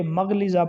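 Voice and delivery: مغلظہ as "magh-liza"; common mispronunciation. Shown as a demonstration. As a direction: say مغلظہ with "a" first, single l. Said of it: "یہ درست نہیں ہے".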